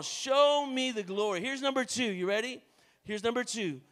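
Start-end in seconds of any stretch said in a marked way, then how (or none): 0.0-1.4 slower, louder cry to God; louder slow plea. Shown as a demonstration. Preacher pushing the church to cry out to God. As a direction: lift it up with force. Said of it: "Come on".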